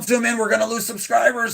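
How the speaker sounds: high-pitched imitation of a worried co-host; mock panicked nagging. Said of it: "She's like".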